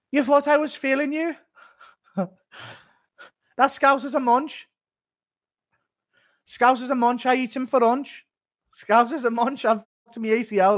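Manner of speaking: high-pitched rapped impression. Fighter rapping a few pop lines, high playful rap. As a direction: rap it in short high put-on bars. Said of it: "Impression of [name] rapping a few lines off Manch by [name]".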